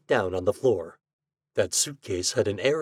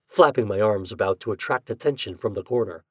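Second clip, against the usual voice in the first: plain reading voice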